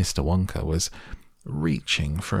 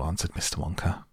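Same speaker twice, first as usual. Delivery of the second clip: plain reading voice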